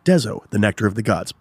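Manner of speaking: low, measured sponsor tagline; mock announcer. Podcaster voicing the sponsor's slogan, low announcer style. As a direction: intone it like a grand commercial.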